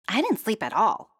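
tired princess griping. A narrator's reading. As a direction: gripe it. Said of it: "Princess griped".

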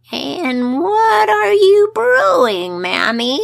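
put-on high slow eerie old-man voice; changeling old man voice. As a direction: draw it out, uncanny and sly.

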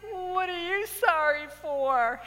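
high, drawn-out whimpering question; mock tearful question. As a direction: ask it in hammed-up weepy bafflement. Retold tearful, baffled question to friend.

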